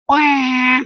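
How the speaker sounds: high mocking crybaby wah; mock baby wail. Mocking the complaining viewer with a fake baby wail. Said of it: "like a baby".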